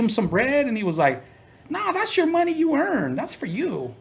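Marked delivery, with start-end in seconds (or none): 1.7-3.9 high put-on voice refusing kindly; imitating Tone. Tone refusing payment.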